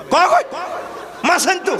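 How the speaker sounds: a wife screeching an order at her husband; mock shrill yell. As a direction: bark it at the husband.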